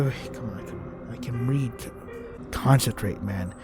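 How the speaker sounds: ordinary delivery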